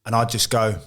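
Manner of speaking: ordinary talk, nothing marked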